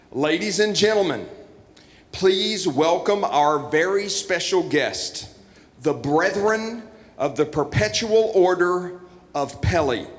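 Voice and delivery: slow, lifted grand introduction; slow ceremonial announcer read; formal announcement. Acted. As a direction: announce the guests like a master of ceremonies. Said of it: "what I've been told to read to you to introduce".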